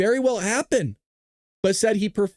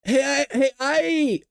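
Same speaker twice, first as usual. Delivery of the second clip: higher, hesitant voicemail voice; mock voicemail caller; 7 semitones higher, slower than usual. Mock Iranian leader leaving Trump a voicemail, stammering. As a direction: stammer into the phone. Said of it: "they just sent Trump the voicemail".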